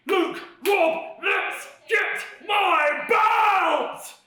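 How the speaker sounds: rallying shout. Real, not acted. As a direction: bellow the rallying cry slowly. Rallying the team.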